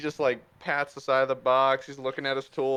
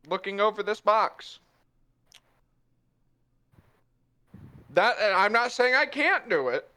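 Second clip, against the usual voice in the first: defensive Puss; 8 semitones higher than usual. Acted. Puss excusing his failure to the party, rising frustration. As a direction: save face, then crack. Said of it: "He's like".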